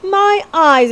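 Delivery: high, loud astonished exclamation; girl astonished by the rat catcher; exclaimed. A narrator's reading. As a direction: blurt it out in shock. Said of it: "exclaimed Betsy".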